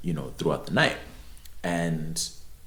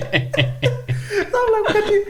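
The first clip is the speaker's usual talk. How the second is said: laughing; 5 dB louder, much slower than usual. Real, not acted. Laughing through retold quote.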